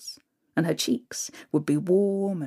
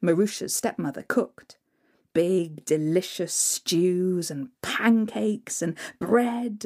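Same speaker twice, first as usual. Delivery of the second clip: ordinary delivery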